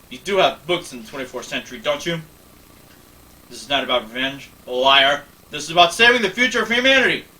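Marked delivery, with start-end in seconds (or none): 3.5-7.3 angry protest. Acted. Picard insisting to Lily it is about humanity, loud. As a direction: shout down her charge. Picard defends himself angrily.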